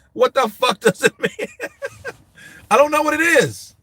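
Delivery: high, loud cry of disbelief breaking into laughter; exasperated bafflement. Real, not acted. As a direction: protest helplessly with a laugh. Baffled by slang while chat laughs.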